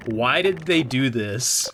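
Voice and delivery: normal talking voice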